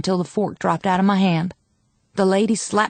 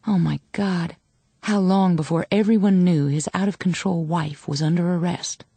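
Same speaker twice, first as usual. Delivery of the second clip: plain reading voice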